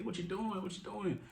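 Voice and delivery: high startled repeat; alarmed high what you doing. Retold or imitated. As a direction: caught off guard by the kid's trust fall, high startled cry. Kid trust-falls into his arms.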